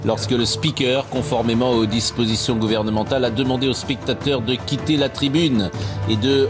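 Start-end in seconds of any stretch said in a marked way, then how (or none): none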